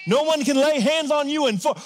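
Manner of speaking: ordinary delivery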